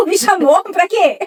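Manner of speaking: mock annoyed. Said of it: "Ou então".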